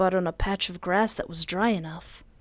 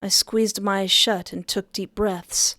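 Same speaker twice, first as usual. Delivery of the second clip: normal talking voice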